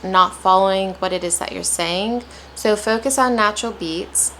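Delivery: normal talking voice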